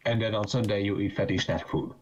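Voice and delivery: ordinary talk, nothing marked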